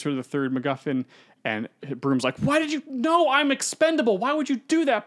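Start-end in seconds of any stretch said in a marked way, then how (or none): 2.4-5.1 much higher, pleading cry; anguished old father. Retold or imitated. Old father begging his son, high anguished plea. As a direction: beg him to understand why.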